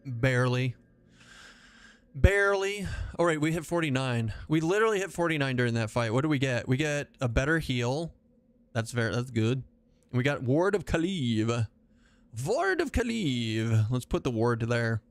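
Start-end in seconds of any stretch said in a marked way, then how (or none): 2.1-2.9 BARELY pitched up and held high; playful exclamation. Pretend. Repeats the word with playful emphasis. 12.5-13.9 high, drawn-out mock-grand spell name; theatrical announcement.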